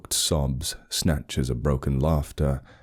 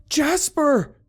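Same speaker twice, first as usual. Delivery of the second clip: much higher, loud panicked yell; frantic shout; 4 dB louder than usual. Acted. Simon yelling for his dog that vanished, high frantic shout. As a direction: scream the dog's name into the woods.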